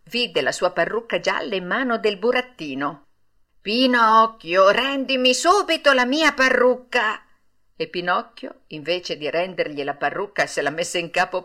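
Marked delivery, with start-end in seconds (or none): 3.6-7.2 demanding. A narrator's reading. Geppetto demands back his wig.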